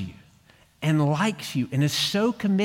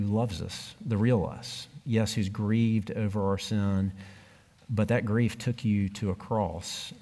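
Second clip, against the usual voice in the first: measured, earnest prayer voice; closing prayer; 3 semitones lower than usual. Acted. Pastor praying over the congregation at the end, earnest and measured.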